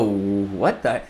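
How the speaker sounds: low, baffled mock reaction; taken aback. Lady reacting to a too-fast caller, taken aback. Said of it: "this lady's gonna go".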